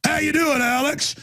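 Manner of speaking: high, put-on friendly howdy. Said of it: "and go".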